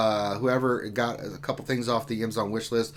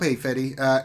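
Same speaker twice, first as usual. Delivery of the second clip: normal talking voice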